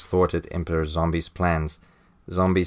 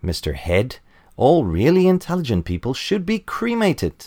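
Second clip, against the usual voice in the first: a higher, louder mock-earnest sidekick; hammed-up cheerful flunky; Mister Groin quote; 8 semitones higher, 3 dB louder than usual. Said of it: "Mister Groin goes on to say".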